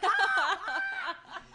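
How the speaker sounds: very high laughing mock shriek of alarm. Retelling Ranma finding himself a girl, high mock shriek. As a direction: shriek like a startled cartoon boy. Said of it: "he's like".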